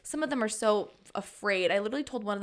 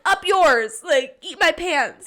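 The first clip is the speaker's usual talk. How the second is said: mock comeback; 6 semitones higher, 9 dB louder, slower than usual. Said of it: "yeah, like".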